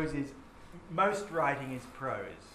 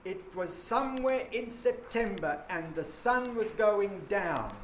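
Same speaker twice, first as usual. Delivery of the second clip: beats pushed high and slowed; verse scanned out loud; rhythmic reading; 6 semitones higher, 5 dB louder than usual. Shown as a demonstration. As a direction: lean on each strong beat slowly. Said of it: "show you what the rhythm is".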